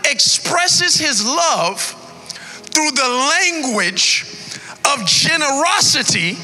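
preacher stirring the congregation; preaching at full force. Acted. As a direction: whoop it out to the church.

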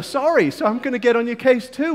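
usual speaking voice

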